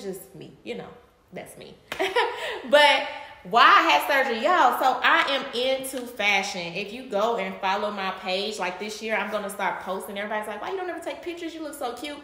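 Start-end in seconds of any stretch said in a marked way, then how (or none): 1.8-2.6 short high laugh. Real, not acted. Amused at her own "that's me", short high laugh. Laughs after describing herself. 10.6-12.2 higher, softer put-on voice; gushing friends imitation. Followers asking for her pictures, high gushing imitation.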